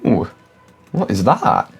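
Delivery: startled high exclamation; surprised. Real, not acted. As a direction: gasp at the creepy costume. Reacting to a scary costume.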